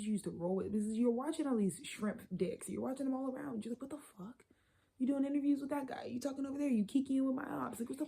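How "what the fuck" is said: shocked whisper. Acted. Acted shocked reaction quote.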